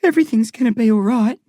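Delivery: lightly voiced boy, quick and eager; a boy rushing to reassure his mum; young boy voice. A narrator's reading. A lightly voiced boy hurries to comfort his mum.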